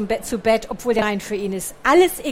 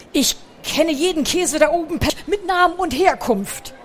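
higher, louder proud mouse voice; proud boast; 6 semitones higher, 3 dB louder than usual. A narrator's reading. Picandu proudly boasting to the little mouse about cheese.

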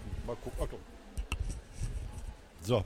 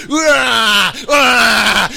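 loud, howling monster shriek; beast roar; 4 semitones higher, 7 dB louder than usual. Acted. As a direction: shriek like a rabid animal. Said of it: "stieß furchtbar gellende Schreie aus".

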